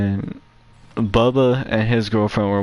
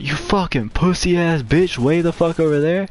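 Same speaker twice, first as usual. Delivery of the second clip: higher-pitched mock yell, no real volume; yelled-out taunt, held back in volume; retold yell; 7 semitones higher than usual. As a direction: imitate someone yelling out an insult. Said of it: "he yells out".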